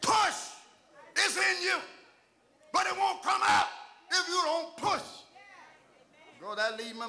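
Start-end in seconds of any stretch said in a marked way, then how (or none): 0.0-5.0 high, forceful shouted preaching; full-force preaching. Real, not acted. Preacher urging the congregation to push for their miracle, shouted preaching. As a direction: drive every phrase home with a shout.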